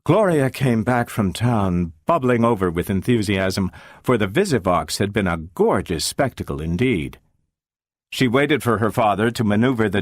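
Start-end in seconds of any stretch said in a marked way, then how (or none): none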